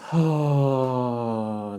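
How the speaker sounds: soft, long sigh out; audible sigh. Shown as a demonstration. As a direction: release the held breath with a sigh. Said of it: "And sigh it out".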